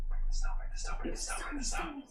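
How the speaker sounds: urgent whispered chant; whispering. Acted. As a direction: hiss the words over and over. Whispered chant urging the stone.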